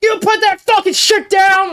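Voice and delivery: high, slow re-enacted angry yell; retold crash-out. Retelling crashing out at the kid, high mock-furious yell. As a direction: act out losing it at a kid. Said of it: "I crashed out at the kid".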